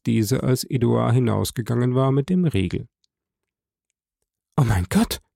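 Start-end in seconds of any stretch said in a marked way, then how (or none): 4.6-5.2 put-on young wife's cry. A narrator's reading. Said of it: "rief die junge Frau".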